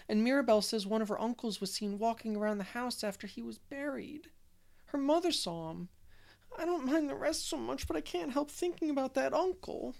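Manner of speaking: put-on a scared child spilling out a ghost story; frightened little girl Dora. Dora confiding her fear to Anne in a tearful little voice.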